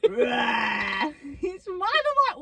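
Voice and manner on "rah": exaggerated weightlifter's roar; mock roar. Showing how she doesn't breathe, mock roar. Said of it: "I don't go".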